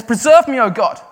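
screaming out. Retold or imitated. Preacher voicing David screaming to God. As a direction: shout for God to save him. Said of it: "he just screams out and says".

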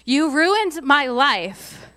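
loud, slow, bitter "you ruined my life"; hammed-up wounded accusation; angry accusation. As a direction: throw the blame at the brothers, hard and slow. Joseph voiced, mock reproach.

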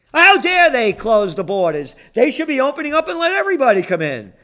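high, fast hammed-up indignation; mock outrage. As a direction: parody the open-borders crowd, loud and fast. Mocking opponents' outrage.

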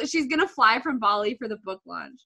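normal talking voice

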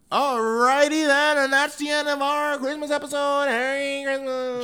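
a cheery elf wishing Merry Christmas. Acted. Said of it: "what the fuck is that voice?".

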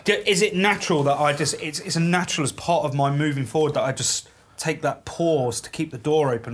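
ordinary delivery

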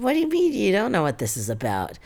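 high-pitched, incredulous comeback; mock incredulous. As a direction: act out disbelief, pitch jumping up. Retelling own startled reply to mom.

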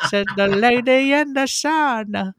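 very high, slow half-sung recital; sing-song lyric voice. Retold or imitated. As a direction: lilt it high like a goofy singer.

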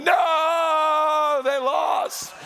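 shouted high mock wail of a losing fan; hammed-up despair at a lost game; loud mock sports-fan groan of defeat; mock anguished yell.

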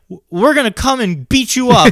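put-on high-pitched kid making a threat; imitated kid caller's threat. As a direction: act out a young prank caller threatening.

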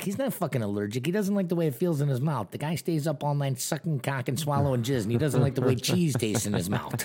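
mock rowdy boss rant. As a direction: roast him like the loud Boston boss. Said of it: "He's like".

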